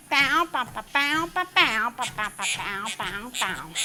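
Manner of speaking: imitated dog barking. Real, not acted.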